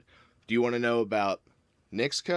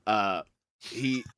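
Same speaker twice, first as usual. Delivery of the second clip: ordinary delivery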